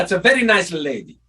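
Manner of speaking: doctor imitated in a Russian-sounding accent; mimicked Russian-accented general doctor; Russian or Prussian accent. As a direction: put on an old-world Russian or Prussian accent. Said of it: "I can do that doctor".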